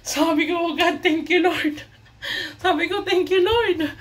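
high, laughing thanks to God; laughing relief. Retold or imitated. Vlogger retelling getting her phone back, laughing high. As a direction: giggle out the thanks, giddy with relief.

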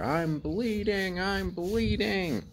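mock bloody-murder cry, stretched out and high; retold panicked scream. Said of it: "I start screaming, like, bloody murder".

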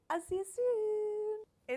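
high, drawn-out sing-song; sing-song goodbye. Real, not acted. Host to the dish going back in the oven, playful sing-song. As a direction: coo it loud and high, stretching the last word.

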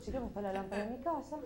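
low, soft, rapid muttering; hungover bewilderment. Acted. Woman puzzles over where she woke, soft low muttering. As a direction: murmur it in morning-after confusion. Acting hungover morning-after confusion.

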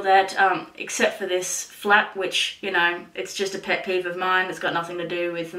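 lips held by fingers; speaking through sheet mask; fingers pulling at lips. Real, not acted. Seen 0: Fingers pinch sheet-mask flap at the lips throughout the labelled words.

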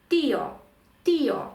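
"Тіо" in two syllables, stressed firmly on the і; наголос на «і». Shown as a demonstration. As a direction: lean on і in "Тіо", then a separate о. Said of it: "наголос саме на «і»". («Тіо» двома складами, з наголосом на «і»)

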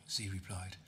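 plain reading voice

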